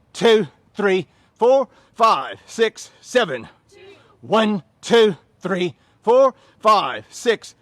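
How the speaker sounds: loud, high count-off over the music; energetic coach calling reps; shouted counts. Real, not acted. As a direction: bark the reps out to the class.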